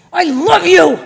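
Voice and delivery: loud, high mock yell of a mother; imitated exasperated mom, blasting it out; harsh mock affection. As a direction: yell the loving words as if scolding. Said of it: "she says it like this".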